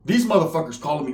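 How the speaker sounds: normal talking voice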